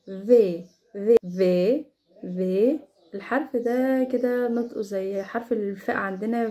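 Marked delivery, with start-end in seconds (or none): 0.1-1.9 في with a heavy F-like V; في said like a thick Arabic فاء; German V sound. Shown as a demonstration. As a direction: press the lip for a weighty في. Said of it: "زي ما أنا جايباه كده".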